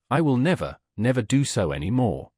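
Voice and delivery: ordinary delivery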